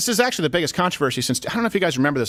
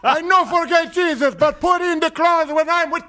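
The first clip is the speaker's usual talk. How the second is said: put-on high, slow Cuban-accented proclamation; hammed-up Cuban accent, drawn out; Yoel Romero; 14 semitones higher, 3 dB louder, slower than usual. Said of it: "in this heavy accent".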